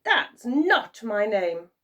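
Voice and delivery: slow, loud teasing; a touch of a smug goblin; gloating imp. A narrator's reading. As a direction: tease them slowly.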